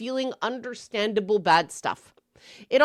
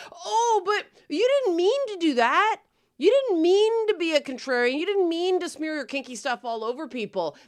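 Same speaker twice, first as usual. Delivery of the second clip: mock whiny excuse; 6 semitones higher than usual.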